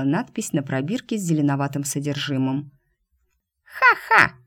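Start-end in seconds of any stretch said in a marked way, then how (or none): 3.6-4.3 high, loud gloating cackle; gloating laugh. Acted. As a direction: cackle like a sneering panto villain. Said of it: "злорадно расхохотался Кыш".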